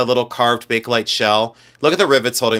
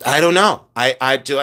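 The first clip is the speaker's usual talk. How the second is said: usual speaking voice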